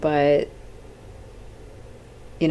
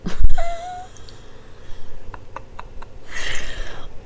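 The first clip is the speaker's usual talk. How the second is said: long high wheezing laugh; helpless laugh; 7 dB louder than usual. Real, not acted. Podcaster realizing how long she has talked, cracking up. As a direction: crack up at yourself.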